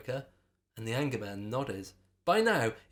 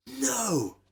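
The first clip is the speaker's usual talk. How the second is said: high sharp cry; yelling; 11 semitones higher, 3 dB louder than usual. A narrator's reading. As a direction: shout it over the roar. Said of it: "yelled Erica over the roar".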